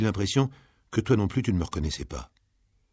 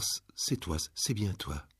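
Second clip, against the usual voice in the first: hushed disbelief; 8 dB quieter, slower than usual. Acted. Blind mother recognizes her son.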